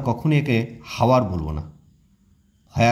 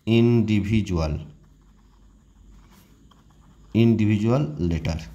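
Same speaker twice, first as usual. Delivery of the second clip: Individual spelled out slowly, syllable by syllable; slow model word; slower than usual. Shown as a demonstration. Teacher slowly models the word for students. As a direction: draw out individual for pupils to copy. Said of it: "teacher models the word".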